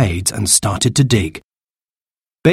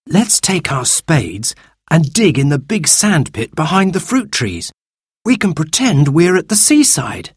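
put-on higher-pitched, eager boy's voice; take-charge eldest boy; 6 semitones higher than usual. Eldest brother proposes digging to his siblings, high and eager. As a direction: play a keen boy rallying the others.

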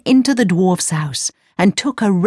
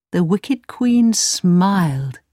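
ordinary delivery

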